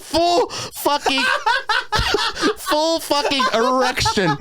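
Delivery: high, slow words squeezed out through laughter; can barely get it out for laughing; real helpless laughter; laughing hard. Real, not acted. Cracking up telling dream.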